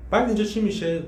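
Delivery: usual speaking voice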